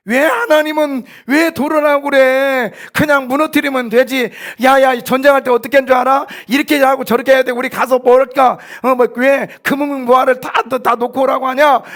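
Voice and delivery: loud, high-pitched indignant complaint; acted grumbling. Pastor voicing Israelites complaining about marching, acted grumbling. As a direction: play an exasperated grumbler protesting the orders. Voices grumbling soldiers at Jericho.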